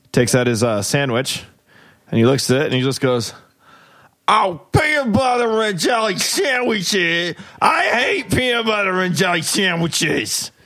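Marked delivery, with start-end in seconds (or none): 4.3-10.5 high, slow whining complaint; high drawn-out grumble over lunch; whiny grumpy old man. Acted. Grumpy factory worker voiced.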